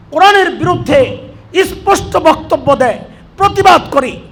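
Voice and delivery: preaching loud and high at full force; rally the crowd with fire; fiery preaching. Acted.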